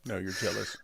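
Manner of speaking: ordinary talk, nothing marked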